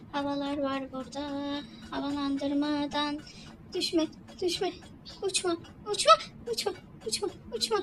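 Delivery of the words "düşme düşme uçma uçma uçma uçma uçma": high, anxious begging; panicked pleading. Real, not acted. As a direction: plead with the character not to fall. Panic while jumping. (panik içinde yalvararak)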